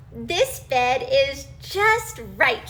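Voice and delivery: content, slow. Acted. Goldilocks content.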